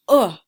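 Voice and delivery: short clipped "uh" sound, loud and clear; isolated short oo sound. Shown as a demonstration. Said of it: "Say that sound with me".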